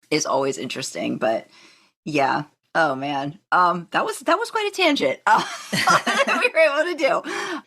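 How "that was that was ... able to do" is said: high, laughing through the words; giggling at the tangent. Real, not acted.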